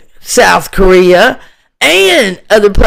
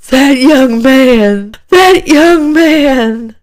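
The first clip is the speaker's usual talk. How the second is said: slow, higher, drawn-out mocking voice; mock wife's statement; 5 semitones higher, slower than usual. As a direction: mimic her saintly forgiving tone, stretched out.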